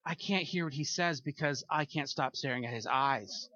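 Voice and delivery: a churchgoer too distracted to listen; distracted listener. A churchgoer quietly confessing he can't stop staring. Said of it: "Wouldn't you be like".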